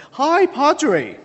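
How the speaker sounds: high, bright imitation of Ali; cheery porter greeting. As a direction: chirp a friendly hello. Ali greets chaplain in elevator.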